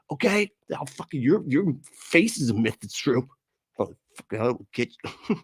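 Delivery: high raspy mock rage voice; mock angry chat troll. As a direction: ham up an angry commenter.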